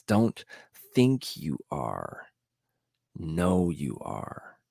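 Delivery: slow, low, measured Morpheus line; quoting Morpheus. Retold or imitated. A vlogger quoting Morpheus to viewers, slow and low. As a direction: lay each word down slowly like a mentor. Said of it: "what Morpheus said in The Matrix".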